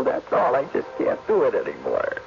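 normal talking voice

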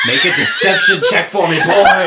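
loud, high shout cracking with laughter; laughing shout. Real, not acted. Game master laughing as he shouts at the player. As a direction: yell it through laughter.

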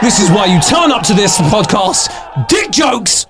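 high-pitched, over-the-top celebration; hammed-up triumphant yell; mock hype shouting. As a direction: holler like an overexcited hype man. Hyped celebration of the pun.

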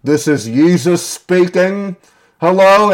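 a kid playing Jesus down the vent; mock Jesus voice. A cellmate on the toilet calling into the vent as a mock Jesus. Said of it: "talking to the vent and be like".

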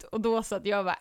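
ordinary talk, nothing marked

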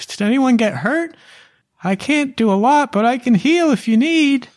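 very high, squeaky little halfling voice; halfling Amelia. Acted. GM voicing the halfling urging the party inside, high and squeaky. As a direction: squeak it out in a tiny urgent voice.

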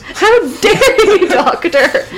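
hammed-up outrage. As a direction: play offended, laughing. Family rebukes doctor, mocked.

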